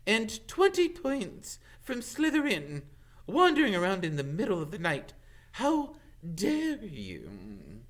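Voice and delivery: high, stern teacher voice; stern scolding. Acted. McGonagall scolds Malfoy in the corridor, stern high. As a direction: dress him down.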